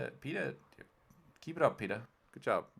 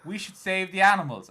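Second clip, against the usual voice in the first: mock-earnest, raised high and loud; activist rallying cry; 9 semitones higher, 9 dB louder than usual. Activists pitching their cause to everyone, mock rallying cry. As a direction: proclaim it like a hammed-up campaigner.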